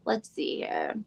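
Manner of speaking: low whisper to herself; whispered aside. Real, not acted. Host reading the event page in a whisper. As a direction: murmur it while reading the screen. Searching online mid-stream.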